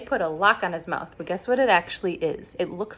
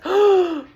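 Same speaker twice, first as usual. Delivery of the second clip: loud, high dramatic gasp; shocked gasp; 11 semitones higher, 8 dB louder than usual. Retold or imitated. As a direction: gasp as if betrayed. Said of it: "And she says".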